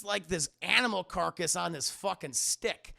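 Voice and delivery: ordinary talk, nothing marked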